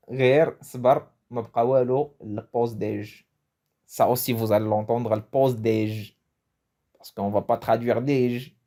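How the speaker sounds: ordinary talk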